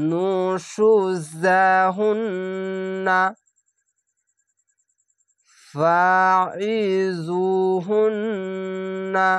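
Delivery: slow, drawn-out chanted recitation; Quranic recitation. Shown as a demonstration. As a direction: recite each word slowly with tajweed. Recited demo.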